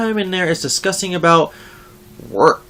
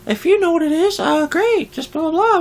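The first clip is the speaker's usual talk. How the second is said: high whiny mocking voice; mock impression of the coworker; 10 semitones higher than usual. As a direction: mimic her in a high nagging whine. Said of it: "She's like".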